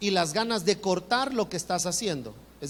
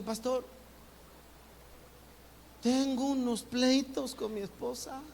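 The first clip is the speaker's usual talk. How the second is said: higher and softer, a confiding husband; husband tells the pastor about fights with his wife, higher and softer; troubled husband; 4 semitones higher, 6 dB quieter than usual. Retold or imitated. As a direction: voice the husband quietly admitting marital fights.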